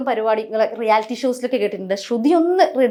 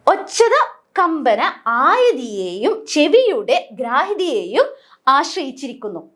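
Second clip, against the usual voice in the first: slow dictation; slower than usual. Shown as a demonstration. Teacher dictating a definition.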